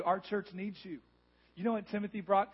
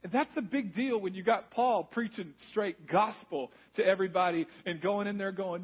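normal talking voice

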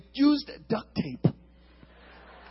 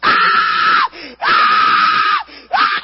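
piercing high screams; screaming in panic; 13 dB louder, much slower than usual. Retold or imitated. Boy fleeing a skittering firework, panicked screams. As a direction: scream like it's chasing you.

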